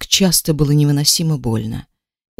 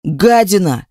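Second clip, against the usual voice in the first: yelling; slower than usual. A narrator's reading. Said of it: "и заорал".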